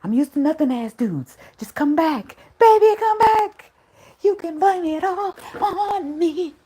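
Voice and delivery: high pleading, slow on the last lines; begging him back. Retold or imitated. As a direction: whine and beg up high. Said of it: "I got on that leg begging".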